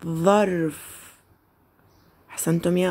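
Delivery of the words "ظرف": ظرف drawn out very slowly, the ظ held clearly; teacher's slow model of ظرف; slow model word. Shown as a demonstration. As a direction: stretch ظرف slowly so pupils hear the ظ. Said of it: "هيا نرددها مرة أخرى".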